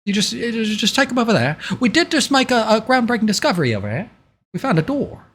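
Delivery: high-pitched character voice, quick in places; game character giving instructions. Acted. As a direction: play an eager high-voiced host at a dig.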